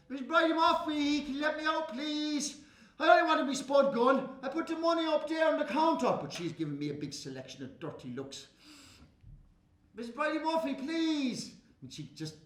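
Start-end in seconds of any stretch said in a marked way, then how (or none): 0.1-6.2 high, begging voice; pleading child. Acted. Boy pleading to be let out. 9.9-11.5 high, begging voice; pleading child. Acted. Boy begging.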